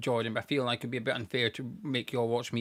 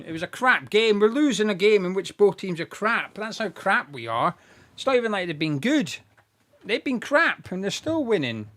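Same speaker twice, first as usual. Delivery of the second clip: loud, exasperated, raised pitch; frustrated rant; 6 semitones higher, 7 dB louder than usual. Real, not acted. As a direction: rant at the useless team. Frustrated rant about losing team.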